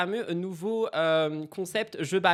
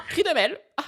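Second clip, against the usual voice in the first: mock cry; 10 semitones higher, 6 dB louder, faster than usual.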